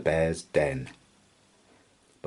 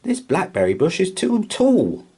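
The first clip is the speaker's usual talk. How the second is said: huffy mouse; 11 semitones higher, 5 dB louder than usual. A narrator's reading. Milly's voice continues.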